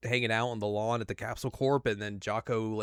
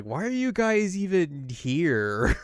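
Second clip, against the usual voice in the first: Beerus annoyed; 7 semitones higher, 3 dB louder, slower than usual. Retold or imitated. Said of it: "Beerus is like".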